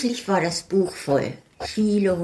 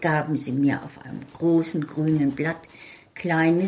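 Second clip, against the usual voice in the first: ordinary delivery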